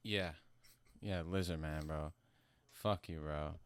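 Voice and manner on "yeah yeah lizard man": ordinary delivery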